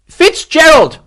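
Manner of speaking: high, loud bellowed name; shouting. A narrator's reading. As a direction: yell for your lieutenant through the trees. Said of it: "Morris shouted".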